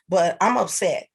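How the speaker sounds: normal talking voice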